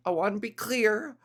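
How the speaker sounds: ordinary talk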